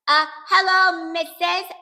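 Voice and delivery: loud, high, drawn-out phone greeting; pretend nurse on phone. A narrator's reading. Child as nurse phones room nine, loud pretend officialdom. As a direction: play at being an official caller.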